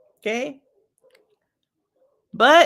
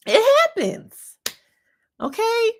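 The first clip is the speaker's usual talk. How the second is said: much higher, slow and drawn; mock casual; 5 dB louder, slower than usual. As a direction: say it high and airy, slowly.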